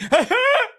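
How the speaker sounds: high fake sobbing outburst; mock crying. Friend breaking down mid-chat about the Knicks, mock sobbing. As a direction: burst into hammed-up sobs out of nowhere. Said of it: "your boy just be like".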